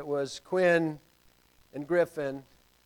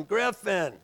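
a touch higher, calling out; mild retold yell for the boy; yelling a name; 4 semitones higher, 4 dB louder than usual. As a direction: call him again. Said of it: "I'm yelling".

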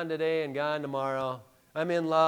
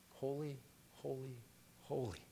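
"holy, holy, holy" dropped much softer, in low awe; hushed reverence; 7 semitones lower, 18 dB quieter than usual. Shown as a demonstration. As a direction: breathe "holy" three times, softly, in reverence. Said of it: "The rule of emphasis there".

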